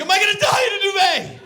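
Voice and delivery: very high shouted panic; mock panic. Someone fearing death in a duvet, mock panic. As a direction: cry out in hammed-up terror.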